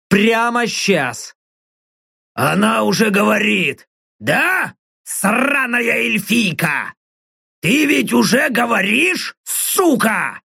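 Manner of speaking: slow rasping orc growl; snarling orc voice. Acted. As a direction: growl at her like a brute. Orc interrogating elf. (рычащий голос орка)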